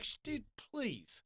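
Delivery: high, hushed, slow brush-off; retold exasperated plea; dismissive plea. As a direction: drop the volume, draw it out. Weary dismissal in retold quarrel.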